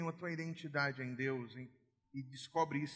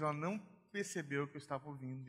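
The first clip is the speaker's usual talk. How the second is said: ordinary delivery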